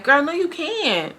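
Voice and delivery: high, slow sassy no; sassy retort. Retold or imitated. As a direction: shut her down with sass. Firm retort.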